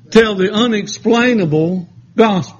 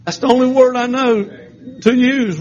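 normal talking voice

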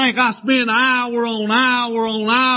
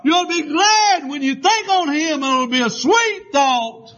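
full-force preaching; 9 semitones higher than usual. Acted. Preacher crying out to the congregation, full-force preaching. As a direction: call it out over the congregation, near breaking.

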